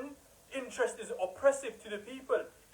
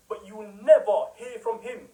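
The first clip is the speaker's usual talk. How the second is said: ordinary talk, nothing marked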